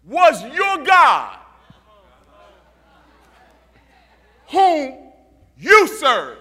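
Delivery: slow, loud, drawn-out preaching; slow loud preacher calling into the den; preaching at full force. Acted. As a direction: build the call like a sermon climax. Sermon retelling the king at the den.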